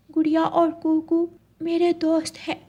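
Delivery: ordinary delivery, nothing marked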